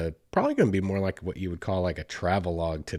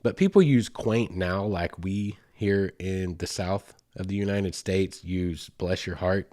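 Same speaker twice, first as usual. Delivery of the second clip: ordinary delivery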